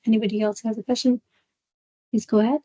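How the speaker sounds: ordinary talk, nothing marked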